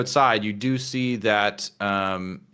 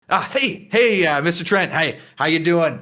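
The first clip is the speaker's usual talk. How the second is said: higher-pitched, gushing hellos; eager greeting; 7 semitones higher, 4 dB louder than usual. Acted. Flynn walks over to greet Mister Trent, eager greeting.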